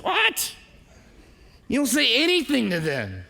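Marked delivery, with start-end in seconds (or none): 0.0-0.6 high gossiping squeak of What; shocked gossip on the phone; mock disbelief. Gossiping to mutual friends about a friend, high mock gasp. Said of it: "you call your mutual friends".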